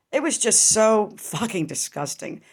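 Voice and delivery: usual speaking voice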